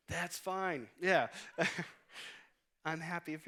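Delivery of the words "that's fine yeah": laughing along. Retold or imitated. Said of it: "I'm like".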